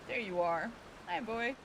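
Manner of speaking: usual speaking voice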